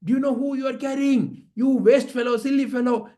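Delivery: high, scolding outburst; furious king. A narrator's reading. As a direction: berate the servant as an enraged king. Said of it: "he got so angry and so upset".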